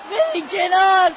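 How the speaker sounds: loud demand to clear the way; angry shout at the crowd. Acted. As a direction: push them aside with the voice. Girl forcing way to parents.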